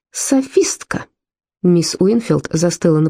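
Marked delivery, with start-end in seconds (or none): 0.1-1.1 hissed in disgust; whispered fury. A narrator's reading. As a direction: spit it out in a furious hiss. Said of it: "прошипела она". (яростный шёпот)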